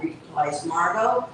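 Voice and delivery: plain reading voice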